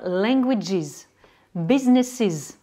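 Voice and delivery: "languages" and "businesses" slowly, each ending in IZ; final IZ syllable. Shown as a demonstration. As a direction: draw out "languages" and "businesses" with a clear extra IZ syllable. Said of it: "va se prononcer is". (« languages », « businesses » lentement, finale en IZ)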